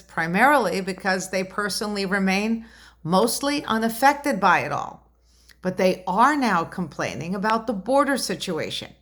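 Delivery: usual speaking voice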